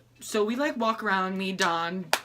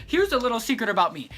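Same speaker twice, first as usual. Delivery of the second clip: ordinary delivery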